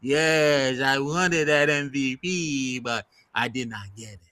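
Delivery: lightly voiced French accent, slow on the MVP; a hint of cartoon French accent; half-hearted French accent as a pouting player; mock French accent. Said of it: "I'm trying to sound like Pepe Le Pew".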